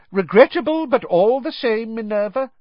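loud, high and pompous; the Minister talking over everyone. Acted. Fudge loudly brushing off McGonagall in the ward. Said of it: "Cornelius Fudge was saying loudly".

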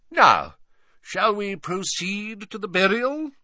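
high, theatrical sorrowful wizard; Slughorn theatrical. Acted. Slughorn laments to Hagrid, high and theatrical. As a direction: ham up the mourning.

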